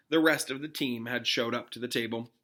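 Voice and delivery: ordinary delivery, nothing marked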